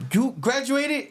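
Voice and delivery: high, disbelieving question; can't believe it, pitch shooting up; stunned warden asking; incredulous. Retold or imitated.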